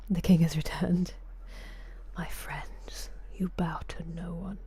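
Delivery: hushed mock-royal announcement; playful whispered royal decree; whispered proclamation. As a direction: whisper it like a grand herald. Mock royal welcome for dog.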